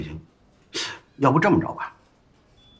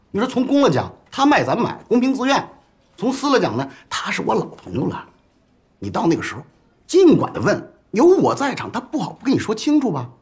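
louder and higher, pushing the point home; emphatic persuasion; 6 semitones higher, 4 dB louder than usual. Acted. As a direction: sell it hard to a doubting junior. Boss persuading subordinate, overriding doubts.

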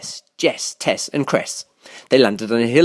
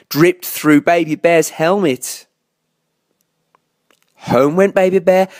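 plain reading voice

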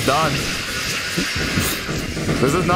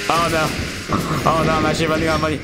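panicked rush; much faster than usual. Real, not acted. Panic about money in game.

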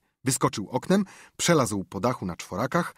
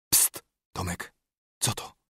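urgent whisper. A narrator's reading. Tom grabbing his friend on hearing a noise, whisper. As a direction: get his attention without being heard. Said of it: "chwycił Haka za ramię i szepnął".

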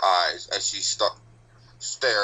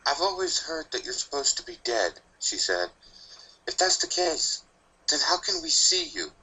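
put-on a higher, timid young filly; nervous filly; 7 semitones higher than usual. A narrator's reading. Sweetie Belle nervously questioning the Reaper, timid and higher. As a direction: ask it shyly like a small child.

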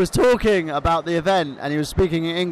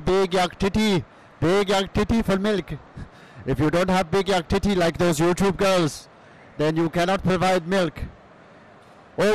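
put-on Iranian cousin accent. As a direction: play the foreign cousin with a thick accent. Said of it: "Michael Burhan's Iranian cousin".

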